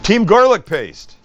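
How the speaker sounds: loud, high rallying cry; excited cheer. Real, not acted. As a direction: cheer it like a team chant. Cheering for team garlic paste.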